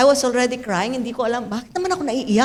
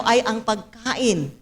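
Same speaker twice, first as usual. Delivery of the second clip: ordinary talk, nothing marked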